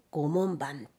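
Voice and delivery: a hint of a low kappa-like call; creepy, muffled gloom. A narrator's reading. Narrator echoing the call that lures like a kappa, creepy low voice. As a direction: call it lightly low and softly eerie. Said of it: "気味悪く陰にこもった声で".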